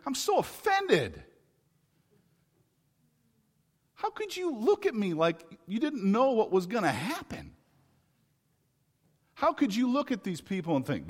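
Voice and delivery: high, loud offended scolding; Jesus scolding his disciples, offended; stern offended Jesus. Retold or imitated. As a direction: play Jesus as hurt and indignant. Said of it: "sternly looking at them and saying".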